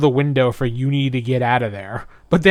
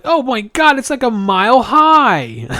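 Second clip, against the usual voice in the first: awed; 9 semitones higher, 5 dB louder than usual. Retold or imitated. Presto awed by beanstalk.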